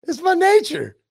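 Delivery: repeating the scorpion's excuse, high and smug, through a laugh; mock scorpion. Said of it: "repeating the scorpion".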